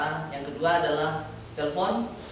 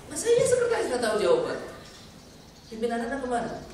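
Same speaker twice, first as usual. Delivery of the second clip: much higher, pushing the secretary hard; pressing tone; 8 semitones higher, faster than usual. Shown as a demonstration. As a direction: demand an answer, leaning on her. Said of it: "mulai ada tekanan-tekanannya". (penguji menekan sekretaris dengan nada tinggi)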